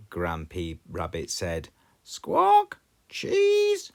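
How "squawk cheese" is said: very high, louder parrot squawk. A narrator's reading. Polly repeating Grampy Rabbit, very high loud squawk. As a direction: screech the word back like a parrot. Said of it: "Polly Parrot repeated".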